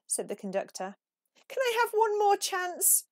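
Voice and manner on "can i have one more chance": much higher pleading voice; begging. A narrator's reading. Peep begging the conductor, high and pleading. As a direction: beg for one more chance. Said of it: "begged Peep".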